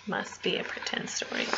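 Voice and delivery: whispering. Real, not acted. Whispered aside.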